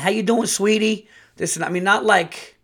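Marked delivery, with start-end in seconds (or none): 0.0-1.1 high, loud gushing greeting; high-pitched mock sugary greeting; over-the-top sweet lady. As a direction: gush it like an overly sweet hygienist. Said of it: "so over the top".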